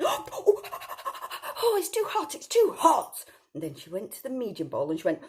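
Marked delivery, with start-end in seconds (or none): 0.0-3.2 put-on much higher, louder squeaky girl complaining; Goldilocks. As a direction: squeal at the hot porridge like a little girl.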